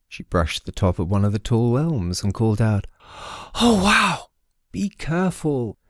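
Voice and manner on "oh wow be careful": surprised. Acted. Sewell brushes the treetops.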